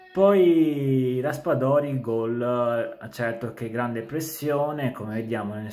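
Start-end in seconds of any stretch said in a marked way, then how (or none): 0.1-3.0 rising excited call of the goal. Real, not acted. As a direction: announce the goal with a burst of excitement. Goal call in match recap. (telecronaca concitata del gol)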